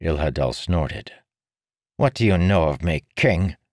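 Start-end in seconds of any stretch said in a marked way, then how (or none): 2.0-3.6 quick, sharp and loud retort; snapping. A narrator's reading. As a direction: snap it back at him. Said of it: "he snapped".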